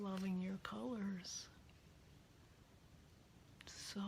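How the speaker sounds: hushed admiring whisper. Real, not acted. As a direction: whisper it softly in awe. Quiet admiration of yarn.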